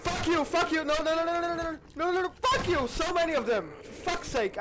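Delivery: loud, high-pitched rapid swearing; frantic high shouts; panicked shouting. Real, not acted. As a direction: yell in real panic at a swarm of attackers. Panicked swearing at game enemies.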